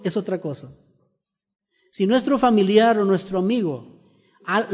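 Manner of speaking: ordinary talk, nothing marked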